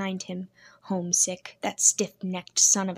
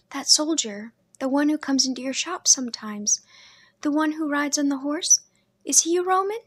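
put-on higher, girlish questioning voice; a curious little sister asking innocently; young sister Leah; 6 semitones higher than usual. A narrator's reading. Little sister asking her brother about brides, girlish and curious.

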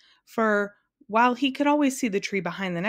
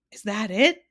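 mild shout, louder with a rising end; excited shout; 2 semitones higher, 5 dB louder than usual. A narrator's reading. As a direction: call out the question eagerly. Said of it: "shouted Milo".

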